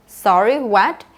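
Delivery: "Sorry, what" lifting into a question; puzzled question, "what" swinging up; rising intonation. Shown as a demonstration. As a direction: raise the pitch at the end of "what". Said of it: "notice my intonation went up".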